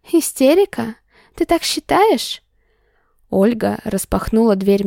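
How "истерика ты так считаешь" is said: high, rising outraged echo; indignant disbelief. Acted. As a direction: throw his word back at him. Heroine indignant, echoing word.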